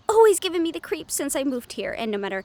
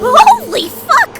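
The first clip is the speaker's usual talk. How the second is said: screamed much higher and louder, drawn out; terrified scream; 12 semitones higher, 16 dB louder, much slower than usual. Acted. Bex screaming as the ghost attacks, terrified scream. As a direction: shriek it in sudden terror. Sudden supernatural scare.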